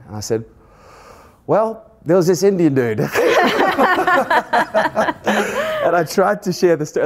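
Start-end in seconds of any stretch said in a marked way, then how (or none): 1.5-3.0 amused. Real, not acted. Answers doctor, starts laughing. 3.0-5.8 real laugh, much higher, out loud; high rising laugh. Real, not acted. Laughs at own answer. 5.8-7.0 higher, laughing as you speak. Real, not acted. Still laughing.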